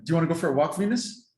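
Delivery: excited owner asking her dog Venus about a walk; enticing excited call to dog. Shown as a demonstration. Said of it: "I'll kinda give you an example".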